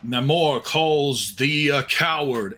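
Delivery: slow, lofty stern hero. Acted. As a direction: proclaim it slowly as a stern hero. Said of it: "stern visage".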